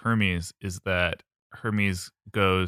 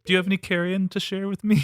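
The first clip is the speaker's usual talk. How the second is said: high cute jackal pup voice; 11 semitones higher, faster than usual. Pretend. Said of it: "And he's just like".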